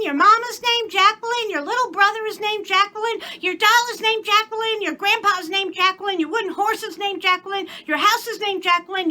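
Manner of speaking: put-on very high child rattling off a list; little girl's voice. A narrator's reading. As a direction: chant it like a know-it-all kid.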